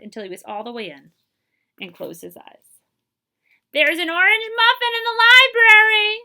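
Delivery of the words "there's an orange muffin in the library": put-on much higher, loud delighted girl voice; giggling girl. A little girl spotting Dewey in the box, high and gleeful. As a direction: squeal it like a thrilled little girl. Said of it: "a girl giggled".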